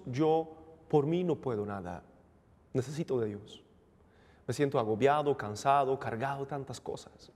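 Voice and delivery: ordinary talk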